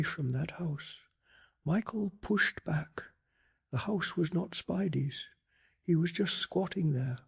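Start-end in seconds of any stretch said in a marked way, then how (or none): none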